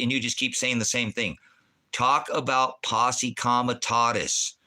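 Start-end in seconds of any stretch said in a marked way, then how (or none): 1.9-4.6 slow, hammered-out words; insistent demand. Real, not acted. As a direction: demand it slowly, word by word. Repeats the angry demand.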